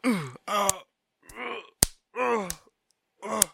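strained grunts, high and loud; choked grunting while being punched; in a headlock. Pretend. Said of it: "You are in the headlock".

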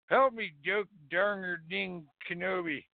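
ordinary talk

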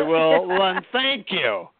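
high, sulky, grudging "thank you"; petulant thanks. Retold or imitated. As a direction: throw the thanks back in a huff. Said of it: "totally pissed".

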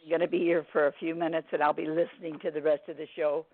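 ordinary talk

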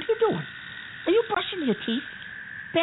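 incredulous. Acted. Shocked she's brushing teeth.

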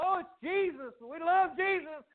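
high-pitched mock adoration; hammed-up, high fawning; gushing devotion. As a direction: gush like a two-faced fan. Hypocritical crowd adoring Jesus, acted.

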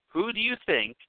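ordinary talk

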